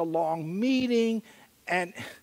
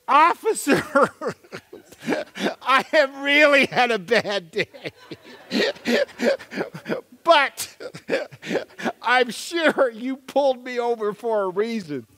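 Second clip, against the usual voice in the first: higher, louder nervous laughing plea; higher laughing plea to the officer; sheepish plea; 5 semitones higher, 4 dB louder than usual. Retold or imitated. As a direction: charm the cop with a guilty chuckle. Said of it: "like this".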